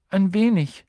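put-on high, timid girl's reply; shy. A narrator's reading. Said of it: "sagte Hermine schüchtern". (schüchtern, mit hoher Stimme)

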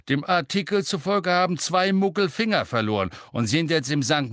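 loud, high-pitched insistent recounting. A narrator's reading. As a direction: talk over the children, loud and emphatic. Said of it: "mit erhobener Stimme". (mit erhobener Stimme, laut übertönend)

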